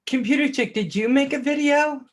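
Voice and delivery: light up greeting a viewer; excited question. Real, not acted.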